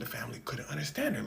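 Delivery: normal talking voice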